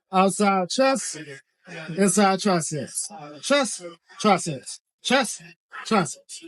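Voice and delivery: each word drawn out slowly, spaced apart; slow coaching cue. Shown as a demonstration. Trainer showing the machine to viewers, slow deliberate cue. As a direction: slowly call out the grips to a student.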